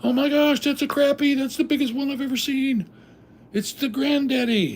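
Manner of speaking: mock freaked-out fishermen; freaking out. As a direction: gush like you can't believe the catch. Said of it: "they were freaking".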